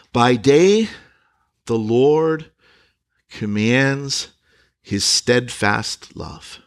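slow, paused reading of the verse; scripture reading. Acted. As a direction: lay each phrase down with long pauses. Verse eight read with pauses.

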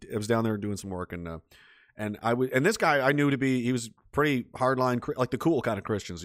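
ordinary talk, nothing marked

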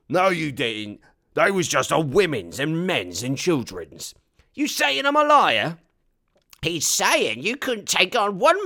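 loud, high cartoonish orc voices; squabbling orcs. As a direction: bicker like uneducated orcs.

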